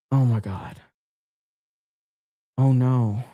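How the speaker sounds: low, hushed-serious feigned horror; mock dismay. Streamer feigning horror to chat over a banned word, mock dismay. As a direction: play gravely appalled at yourself.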